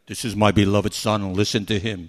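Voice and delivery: deep, loud booming yell down from heaven; God yells down at Peter from heaven, booming loud. Retold or imitated. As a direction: thunder it down like an angry God. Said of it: "he yelled down at Peter".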